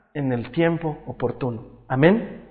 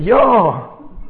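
loud, raised toy voice; playful bear shout; 5 semitones higher, 8 dB louder than usual. Retold or imitated. Toy bear answering dad's milk offer, playful shout. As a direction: pipe up loudly as the teddy.

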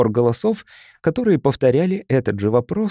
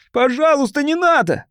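very high, panicked begging; pleading; 13 semitones higher than usual. A narrator's reading. Cornered man begging neighbors to stop, frantic pleading. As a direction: plead desperately for mercy. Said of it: "взмолился он".

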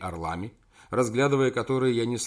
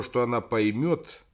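ordinary delivery, nothing marked

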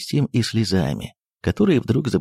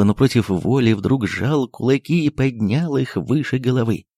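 ordinary delivery, nothing marked

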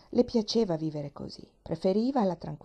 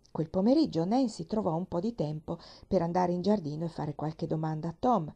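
plain reading voice

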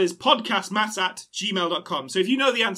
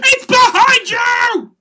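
slow drawn-out panto shout; panto crowd callback; 11 dB louder, much slower than usual. Pretend. Panto shout repeated slower.

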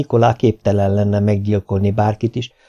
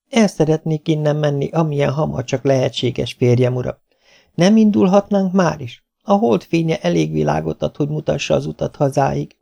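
higher pleading wife's voice; a touch of despair, pitched up; mild desperation; 6 semitones higher than usual. A narrator's reading. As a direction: plead to leave, with understated desperation. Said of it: "A kétségbeesés eltéveszthetetlen volt a hangjában".

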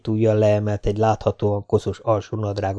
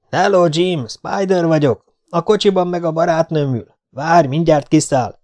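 higher call across the yard, slowing at the end; 7 semitones higher than usual. A narrator's reading. Spider announces himself to Jim from outside the house, calling out. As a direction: call out to the hidden man. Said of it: "elkiáltotta magát".

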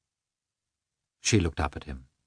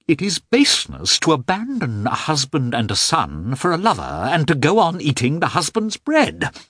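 angry accusation; 7 semitones higher than usual. A narrator's reading. Husband accusing his wife of baseness, angrily. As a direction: build to a shrill accusing pitch.

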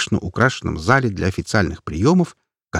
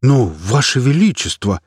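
astonished; 4 semitones higher, 4 dB louder than usual. A narrator's reading. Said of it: "изумлённо уставился тот".

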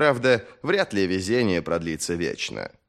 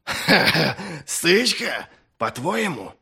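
mocking laugh; 7 semitones higher, slower than usual. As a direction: laugh it off with scorn. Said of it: "Чёрный Доу снова захохотал".

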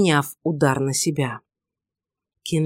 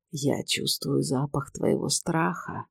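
slow, low, much softer menace; snake-like voice; 3 semitones lower, 8 dB quieter than usual. A narrator's reading. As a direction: breathe the threat like a serpent. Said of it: "змеиный голос Дамиуса".

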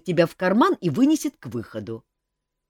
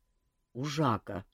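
grumbling; 2 semitones lower, 7 dB quieter, slower than usual. A narrator's reading. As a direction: mutter it sourly. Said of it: "проворчал Кантор".

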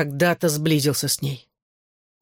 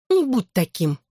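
higher, mildly pleading; sighed plea; 5 semitones higher than usual. A narrator's reading. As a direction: coax with a touch of a sigh. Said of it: "вздохнула она". (упрашивая, со вздохом)

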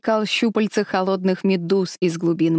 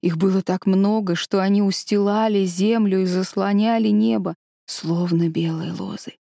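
ordinary delivery

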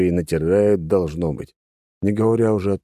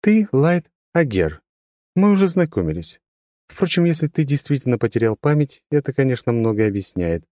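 high, level, unchanging tone; monotone girl; 7 semitones higher than usual. A narrator's reading. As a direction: say it with no inflection. Трила speaks.

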